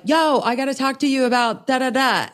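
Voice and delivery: high, lightly voiced driver butting in; driver's breezy interruption.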